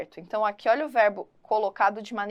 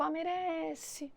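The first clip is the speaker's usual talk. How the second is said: mock doubtful "merece", drawn out and softer; 5 semitones higher, 11 dB quieter, slower than usual. Shown as a demonstration. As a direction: say "merece" as if unsure. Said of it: "Não, né? ... não faz entonação". ("merece" dito com dúvida, devagar e baixo)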